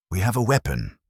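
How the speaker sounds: quick nervous blurt. A narrator's reading. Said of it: "the tall Huey blurted out".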